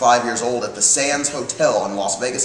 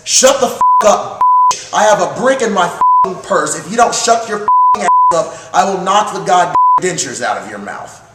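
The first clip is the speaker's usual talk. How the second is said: raised, louder retold tirade; grandmother's angry threat; 5 semitones higher, 5 dB louder than usual. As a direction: lay into her like a fiery grandmother. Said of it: "My grandmother then says".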